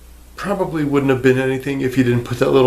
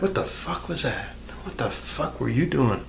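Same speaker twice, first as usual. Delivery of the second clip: quiet mock disbelief; 10 dB quieter than usual. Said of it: "You're like".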